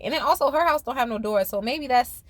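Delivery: usual speaking voice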